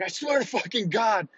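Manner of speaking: high, strained threat; exasperated instructor. Retold or imitated. Instructor warns recruit who keeps screaming, high strained voice. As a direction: imitate an instructor at his wits' end. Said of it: "And he's like".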